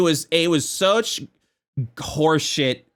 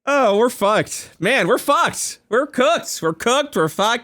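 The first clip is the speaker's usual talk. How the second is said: mock despair; 8 semitones higher, slower than usual. Mock despair over funny AI.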